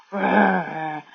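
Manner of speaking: rolled growling roar at moderate volume; mild acted roar. A narrator's reading. Jack acts like a gorilla at the leopard, mild roar. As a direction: growl out a restrained storybook roar. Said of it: "he roared".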